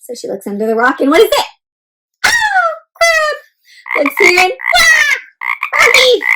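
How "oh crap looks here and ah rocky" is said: high, startled cries; surprised exclamations. Acted. Teacher acting out Nimbus finding things under rocks, high and startled. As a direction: jump at each discovery.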